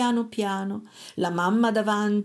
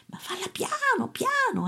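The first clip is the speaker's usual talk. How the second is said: piano piano, high and hushed; high soft pleading; 13 semitones higher, 3 dB quieter than usual. A narrator's reading. Mother urging her son to go softly, high and hushed. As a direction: beg him to be quiet.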